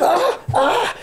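high mock pain scream; retold scream. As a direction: yelp it out high, imitating the pain. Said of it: "And I started screaming".

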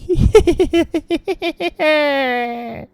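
long, drawn-out creepy laughter; eerie laugh. Acted. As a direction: laugh like something lurking. (моторошний сміх)

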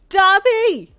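high, full-force scream of a name; desperate scream. A narrator's reading. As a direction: scream out for someone while running. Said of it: "you screamed as you ran".

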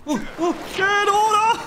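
high, frantic yell; panicked shriek. Real, not acted. Gamer attacked mid-heal, shrieking high in panic. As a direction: shriek to get it away. Monster attacking while healing.

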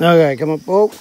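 loud straining shout; tug of war. Real, not acted. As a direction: heave and shout encouragement. Pulling child out of mud.

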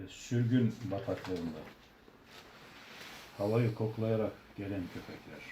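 slow, solemn poem reading; poem recitation. Acted. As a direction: recite it slowly and gravely. Recites his own poem.

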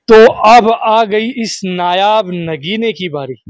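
धीमी, ज़ोरदार प्रस्तुतकर्ता वाली घोषणा; अगले बकरे का ऐलान शान से करो; announcer build-up. Acted. Host announces next goat dramatically. (Host दर्शकों को अगला बकरा पेश करता है, धीमी ज़ोरदार घोषणा)

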